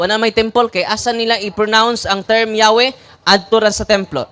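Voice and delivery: normal talking voice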